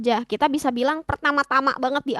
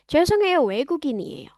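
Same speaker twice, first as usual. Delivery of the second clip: quick apology, 죄송해요 high and loud, 외국인이에요 falling away; natural conversational demo; 3 semitones higher, 3 dB louder, much faster than usual. Shown as a demonstration. Foreigner excusing themself to a Korean, brisk apology. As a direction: say 죄송해요, 외국인이에요 at everyday speed. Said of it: "Kayak gitu".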